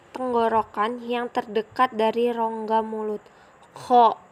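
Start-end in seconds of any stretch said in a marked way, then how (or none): 3.7-4.2 "Kho" rasped from the throat like a snore. Shown as a demonstration. Teacher demonstrating the throaty letter kho like snoring. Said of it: "seperti orang mendengkur".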